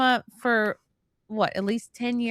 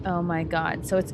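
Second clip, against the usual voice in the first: ordinary delivery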